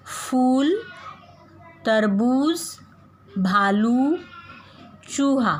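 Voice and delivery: हर शब्द में ऊ लंबा, ऊंचा खींचें; बच्चों को लंबे ऊ वाले शब्द पढ़ाते हुए; long oo reading. Shown as a demonstration. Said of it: "बड़ी ऊंची वाले मात्रा के शब्द". (बड़ी ऊ वाले शब्दों का स्पष्ट पाठ)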